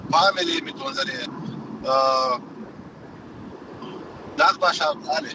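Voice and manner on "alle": usual speaking voice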